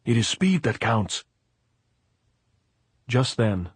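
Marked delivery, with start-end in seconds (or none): 0.0-1.2 mildly raised, impatient voice; raging. A narrator's reading. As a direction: insist with a touch of anger. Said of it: "Pilar raged".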